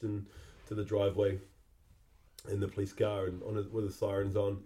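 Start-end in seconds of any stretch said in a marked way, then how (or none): none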